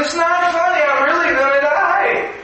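high, indignant mock wail; panicked child. Little boy to the laughing big boys, high mock panic. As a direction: protest like a frightened little boy. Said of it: "he thought he was in trouble".

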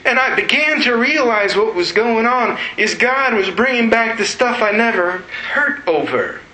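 high-pitched, overwrought preaching delivery; impassioned testimony. Real, not acted. Preacher recounting two days of uncontrollable crying, impassioned testimony. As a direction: pour out the grief at a sustained high pitch. Impassioned retelling of grieving in restaurant.

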